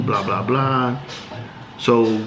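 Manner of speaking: ordinary talk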